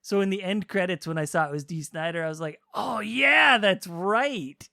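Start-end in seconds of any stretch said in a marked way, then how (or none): 2.7-4.7 higher, louder "aha" reaction; reenacted realization. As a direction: play back the moment it clicked. Reenacts his reaction to the credits.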